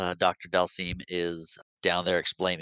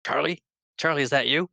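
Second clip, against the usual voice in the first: raised, questioning voice for Guile; retold Guile line; 7 semitones higher, faster than usual. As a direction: ask it like you half recognize him. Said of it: "he's like".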